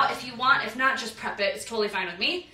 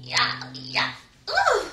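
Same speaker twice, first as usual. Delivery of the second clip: mock ballroom flair; 10 semitones higher, much slower than usual. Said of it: "if you wanna be like".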